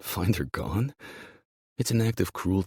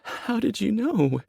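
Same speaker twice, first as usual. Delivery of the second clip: very high, slow tearful question; anguished, high-pitched voice; 5 dB louder, slower than usual. Acted. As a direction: ask it on the verge of crying. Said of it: "Fresh tears well in her eyes".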